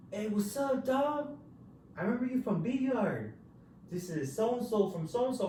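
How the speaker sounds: high, friendly yelled greeting; inmate calling out. Retold or imitated. Inmate greeting speaker from cage, high call. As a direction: hail him across the block.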